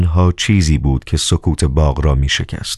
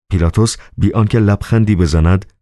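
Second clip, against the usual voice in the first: usual speaking voice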